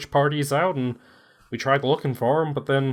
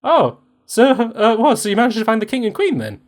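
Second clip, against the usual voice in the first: advisor's voice; 6 semitones higher, 5 dB louder than usual. Acted. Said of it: "one of the advisors looks at you and goes".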